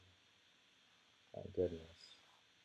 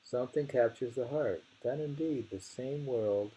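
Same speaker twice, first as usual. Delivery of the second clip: recital growing louder toward the end; poem reading building; 4 semitones higher, 4 dB louder than usual. Acted. Rereading the verse to a friend, growing louder. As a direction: lean harder into the last lines.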